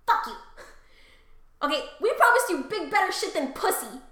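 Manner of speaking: loud, high-pitched mock outrage; hammed-up indignation. Spurned goddess telling off Paris, loudly indignant. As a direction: spit out a vain, offended rant.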